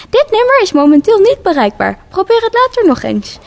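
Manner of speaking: posh automated woman telling you the number can't be reached; posh woman's voice. Acted. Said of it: "een bekakte vrouwenstem".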